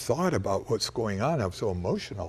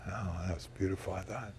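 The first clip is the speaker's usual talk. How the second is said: quiet; 4 semitones lower, 11 dB quieter, faster than usual. Real, not acted. Quiet reflection after the call.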